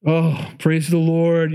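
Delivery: drawn-out, overcome thanks; mother thanking God, slow and moved; slow tearful praise. Acted. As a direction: breathe it out in slow relief. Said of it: "You can just hear her".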